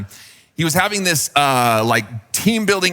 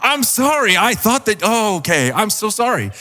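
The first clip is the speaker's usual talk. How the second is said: flustered apology; 7 semitones higher than usual. Retold or imitated. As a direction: scramble to apologize, mortified. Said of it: "I was super embarrassed by that".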